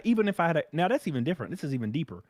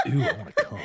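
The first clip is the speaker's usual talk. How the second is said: mock creep voice. Man mocking a guy aroused by crying, laughing. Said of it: "That sounds like weird rapey stuff".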